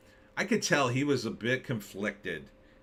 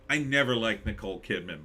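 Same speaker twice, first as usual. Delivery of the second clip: usual speaking voice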